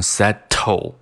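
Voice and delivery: "settle" with a strong puffed t; hard t, not flapped. Shown as a demonstration. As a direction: say "settle" as set-toe. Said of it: "不把它读成settle". (送气t读法)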